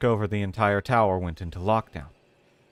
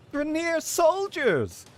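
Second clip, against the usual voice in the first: much higher, slow gleeful cry; childlike excitement; much slower than usual. Acted. Bilza spotting Grineer soldiers, childlike excitement. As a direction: gasp it out like a thrilled kid. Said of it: "with an almost childlike excitement".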